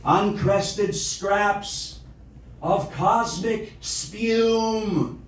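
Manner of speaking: incantatory poem reading, measured phrases with deliberate pauses; poetry recitation. Acted. Recites own poem after plain chat.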